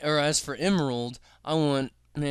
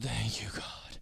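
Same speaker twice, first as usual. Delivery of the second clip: whispered thanks of relief; whispering; 4 semitones lower, 8 dB quieter than usual. Real, not acted. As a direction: breathe it out in relief.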